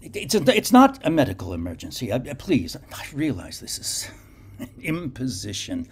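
high, halting and anxious; a shaken man waking someone late; nervous stammering. Acted. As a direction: stumble over apologies urgently. Garak nervously calls the doctor.

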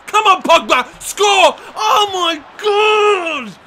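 high, loud urging into drawn-out anguish; agonised match-play outburst; desperate shouting. Real, not acted. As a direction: yell for the goal, then wail in disbelief. Chance to score missed.

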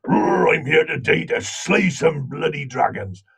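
big Welsh warrior blacksmith with a roar; very strong Welsh accent. Retold or imitated. Actor auditioning as a warrior blacksmith, in a very strong Welsh accent. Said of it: "this very strong Welsh accent".

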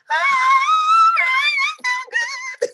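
very high, loud wailing laugh; shrieking laugh. Real, not acted. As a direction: cackle it out to a shriek. Laughing hard after the joke.

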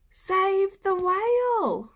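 high, loud, slow announcement; announcing the trail's message; proclaiming. Acted. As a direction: read it out grandly.

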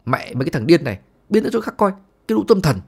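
irritated shout, jumping high; exasperated driver yelling, pitch shooting up; angry yell. Acted. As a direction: bark at the lunatics to get out of the way. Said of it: "rồi quát".

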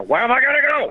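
high, strained plea of a film star; hammed-up actor impression, pitched high; Al Pacino. Comic demonstrates Serpico-era Pacino, high and nasal. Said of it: "he continued that with Serpico".

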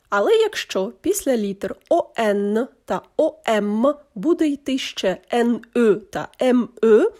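usual speaking voice

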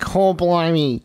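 slow, drawn-out mock-Aussie exclamation; hammed-up Australian accent. Teasing co-host who says stop, hammed-up Australian accent. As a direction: stretch it out in broad Australian. Hamming up slang, told stop.

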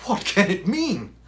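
loud, high, alarmed gasp; gasped question. Acted. Watson to Holmes after the horrible cry, gasping. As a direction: gasp it out in shock. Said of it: "I gasped".

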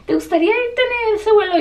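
lilting high imitation of a service agent; airline agent's offer. Airline agent offers the stranded passenger a seat, high sing-song imitation.

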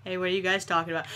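normal talking voice

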